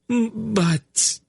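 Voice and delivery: high, stumbling start; a lady losing her words; high, halting stammer; faltering. Acted. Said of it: "Narina faltered".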